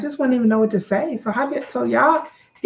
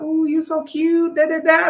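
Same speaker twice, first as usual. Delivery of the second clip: higher-pitched gushy flattery, rattled off at the end; mock gushing admirer; 6 semitones higher, 3 dB louder than usual. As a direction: lightly imitate a flirty fan. Imitating women's flattering DMs.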